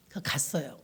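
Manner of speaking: whisper. Real, not acted. Whispered aside in story.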